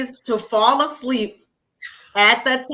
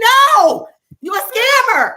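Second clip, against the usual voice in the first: much higher, loud shouted refusal; 10 semitones higher, 10 dB louder, slower than usual. Retold or imitated. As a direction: shout him down, high and loud. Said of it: "I was like".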